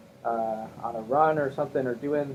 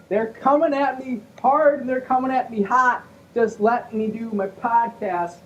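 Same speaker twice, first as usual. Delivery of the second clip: a high, put-upon complaint that slows at the end; an exasperated son begging to be left alone; Ray Romano; 8 semitones higher, 3 dB louder than usual. Acted. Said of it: "my Ray Romano impression".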